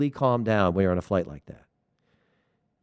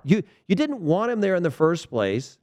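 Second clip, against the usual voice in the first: ordinary talk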